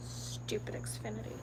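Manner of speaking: under-the-breath whisper of disgust; whispered grumble. Real, not acted. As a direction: hiss it to yourself, annoyed. Muttering to herself.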